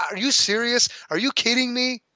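can't believe what he's hearing; high, exasperated are-you-kidding-me; incredulous outrage. Real, not acted. Ranting about Dolphins' quarterback choice.

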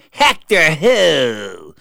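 loud, slow, drawn-out scornful demand; imitating a nasty ex on the phone; witchiest ex-wife. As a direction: stretch it out with witchy contempt. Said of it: "in her witchiest".

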